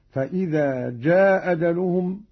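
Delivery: جاء أجلهم as "jaa ajaluhum", one hamza, short aa; dropped hamza, short. Shown as a demonstration. Teacher showing qasr, جاء short before أجلهم, one hamza. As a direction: drop the hamza of جاء and keep its vowel short. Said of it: "بإسقاط الهمزة الأولى مع القصر والمد".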